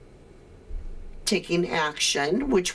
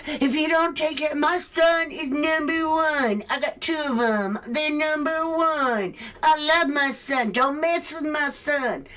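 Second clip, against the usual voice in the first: mock whiny parent; 7 semitones higher than usual. Mocking the family bragging about their son, whiny mock voice. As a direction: ham up a bragging, petulant parent. Mocking the same tantrum figure.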